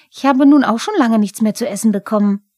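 a stamping goblin ranting about the cat; angry Pumuckl. Acted. Pumuckl beschwert sich.

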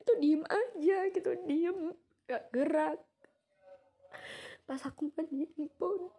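high, strained, emotional voice; real grief. Real, not acted. Recalls washing the dead grandmother's body.

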